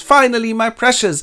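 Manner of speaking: mock triumphant Gollum. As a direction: crow over the prize like a crazed creature. Gollum gets the ring.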